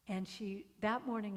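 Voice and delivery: ordinary delivery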